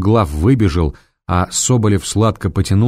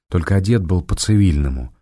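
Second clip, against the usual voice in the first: ordinary delivery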